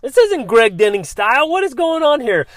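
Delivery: acting out a sudden wake-up call to himself; incredulous self-questioning. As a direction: catch yourself and protest in a high voice. Acts out his sudden self-questioning.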